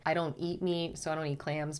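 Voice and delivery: ordinary talk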